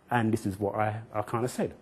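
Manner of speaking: ordinary delivery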